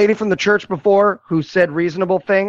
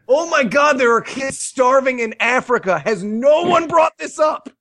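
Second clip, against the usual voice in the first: higher, mock-appalled outburst; exasperated outrage; 6 semitones higher than usual. Viewer reacting to a puerile movie, exasperated outrage. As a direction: build to an incredulous shout.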